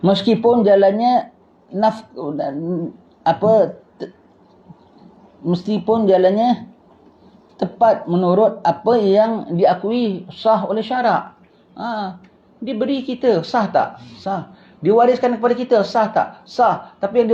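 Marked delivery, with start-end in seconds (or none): 0.0-11.4 slow, deliberate reading of the kitab; teacher reading the ruling aloud to students; text reading. Acted. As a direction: read the ruling out clearly and slowly.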